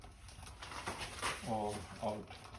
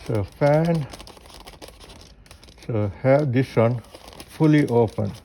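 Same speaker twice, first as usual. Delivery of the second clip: ordinary delivery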